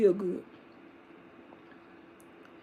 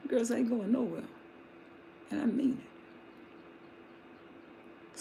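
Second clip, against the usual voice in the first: softer, slow whisper; hushed emphasis; 14 dB quieter, much slower than usual. Real, not acted. Preacher telling the congregation quietly, firm and whispered. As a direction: lean in and whisper it.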